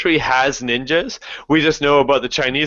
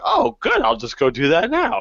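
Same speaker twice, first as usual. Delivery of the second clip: high-pitched mock-grateful reply; sarcastic; 9 semitones higher than usual. Traveler answering useless advice about a far-off photo, sarcastic. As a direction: pipe up with fake enthusiasm.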